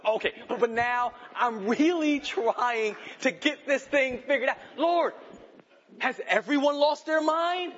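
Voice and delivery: pleading with God, building from a halting start to a loud, high cry; desperate prayer. Acted. Pastor acting out his own midnight prayer, building to a loud cry. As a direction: cry out to God as if at wits' end. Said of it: "I've done it".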